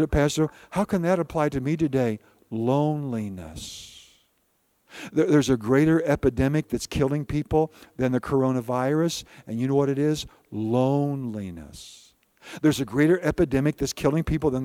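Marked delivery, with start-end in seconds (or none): 2.5-3.9 lower, slow, soft loneliness; hushed reveal. Real, not acted. Pastor revealing the hidden epidemic, softly. Preacher drops voice for answer. 10.5-12.1 lower, slow, soft loneliness; hushed reveal. Real, not acted. Pastor revealing the hidden epidemic, softly.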